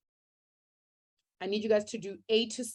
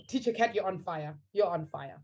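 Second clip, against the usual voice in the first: ordinary talk, nothing marked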